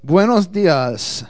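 slow, drawn-out booming greeting; lucha libre announcer. Pretend. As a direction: stretch it out like a ring announcer. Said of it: "why I'm talking in Spanish".

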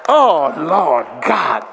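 loud, slow laughing outcry; anguished shout. Retold or imitated. Recalling a sleepless night before his speech, laughing shout. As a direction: cry out in comic anguish. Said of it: "retelling his dread".

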